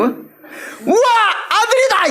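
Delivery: high, bursting with joy at a son; joyful cry. Shown as a demonstration. Mother overjoyed at her newborn son, high excited cry. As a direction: shout it out like a delighted mother. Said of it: "이렇게 읽어야 된다니까요".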